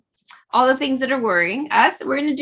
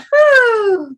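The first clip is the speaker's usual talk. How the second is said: high whooshing whew; loud exhale; 10 semitones higher, 7 dB louder than usual. Real, not acted. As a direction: blow the breath out loudly. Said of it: "a little breath out".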